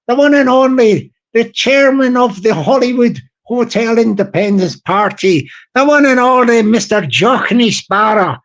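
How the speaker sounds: hammed-up Irish MC introducing the star; big Irish compère voice; Irish accent. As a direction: announce the guest speaker in a put-on Irish brogue. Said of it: "I can do the Irish voice".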